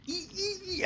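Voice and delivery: tiny, very high-pitched squeaks. Acted. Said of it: "my mouth is squeaky".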